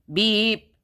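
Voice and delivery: loud horn beep; a loud little beep; truck beep. A narrator's reading. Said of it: "said Blue".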